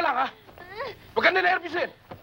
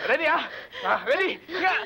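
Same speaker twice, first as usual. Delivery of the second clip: ordinary talk, nothing marked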